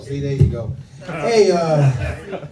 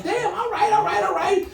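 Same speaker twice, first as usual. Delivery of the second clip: high, amazed damn with a laugh; much higher, laughing all right; impressed, surprised; 14 semitones higher than usual. Retold or imitated. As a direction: go way up in pitch, wowed. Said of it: "I was like".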